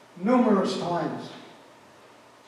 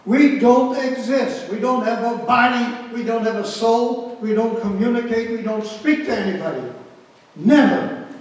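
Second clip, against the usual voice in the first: forceful declaration; 5 semitones higher, 10 dB louder than usual. Real, not acted. Survivor tells listeners how they had to vanish, loud and insistent. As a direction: drive home each We don't like a vow.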